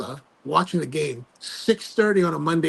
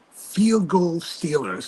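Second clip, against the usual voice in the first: slow, heavy field goal Steelers; dramatic slow reveal; much slower than usual. Real, not acted. Fan revealing the last-second Steelers field goal, slow and heavy. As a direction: let each word drop like bad news.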